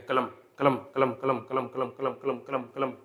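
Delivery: कलम said fast over and over, मा slipping through unstressed; quick repeated demo. Shown as a demonstration. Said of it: "एक ही झटके में निकल जाए".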